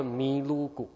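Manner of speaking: 尼鲁古 said slowly, syllable by syllable; imitating a professor's Japanese-style milk; Japanese-style loanword. Pastor imitates Japanese-trained professor saying milk slowly. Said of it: "牛奶怎么说你知道吗".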